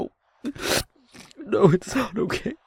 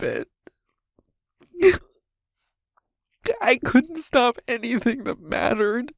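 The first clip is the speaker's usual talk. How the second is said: distraught, voice pitched up, halting between phrases; anguished self-blame; 5 semitones higher than usual. Acted. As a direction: confess failure in rising anguish. Anguished confession of failure.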